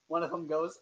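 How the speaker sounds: ordinary delivery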